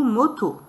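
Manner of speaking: "moto" said in one go; whole word at once. Shown as a demonstration. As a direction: join both syllables of "moto" without a break. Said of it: "on redit tout d'un coup".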